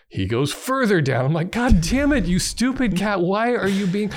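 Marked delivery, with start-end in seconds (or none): 1.5-4.1 exasperated scolding. Retold or imitated. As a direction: berate the cat in worked-up mock fury. Retold scolding of the cat.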